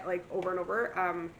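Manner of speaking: usual speaking voice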